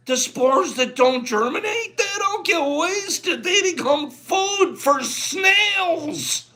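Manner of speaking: high, tearful and slowed, voice cracking with emotion; carried away, near tears. Real, not acted. Teacher moved by the spore text, high and tearful. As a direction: overcome as he reads aloud, almost crying. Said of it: "I'm getting carried away over here".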